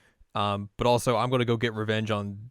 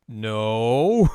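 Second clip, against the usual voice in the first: loud flat denial; 9 dB louder than usual. Retold or imitated. Majima's obvious lie.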